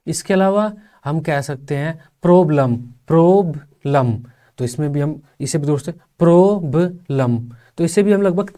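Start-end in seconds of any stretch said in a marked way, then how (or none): 3.1-4.1 प्रॉब्लम in two slow beats, प्रॉब then लम; split in two. Shown as a demonstration. Teacher dividing प्रॉब्लम for students, split in two. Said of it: "प्रॉब्लम भी हमारा तीन शब्दांश वाला". 6.2-7.4 प्रॉब्लम in three slow beats, प्रॉ-ब-लम; syllable by syllable. Shown as a demonstration. Teacher showing three syllables in प्रॉब्लम, syllable by syllable. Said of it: "तीन शब्दांश वाला हो जाता है".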